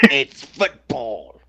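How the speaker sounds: put-on slow, drawn-out English accent; a slow, put-upon English accent. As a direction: play an annoyed English fan in an English accent. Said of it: "upset English people who then go".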